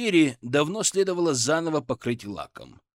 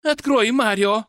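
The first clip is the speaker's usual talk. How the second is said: high, loud, slow pleading shout; 8 semitones higher, 7 dB louder than usual. A narrator's reading. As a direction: scream it at the door. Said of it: "внезапно закричала женщина".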